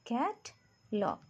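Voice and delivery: "Catalogue" slowly split as cat-log; teacher clapping out two syllables in "catalogue". Shown as a demonstration. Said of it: "तो टू syllable".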